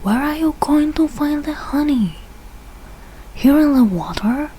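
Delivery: plain reading voice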